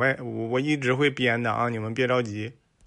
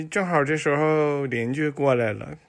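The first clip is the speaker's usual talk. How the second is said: ordinary talk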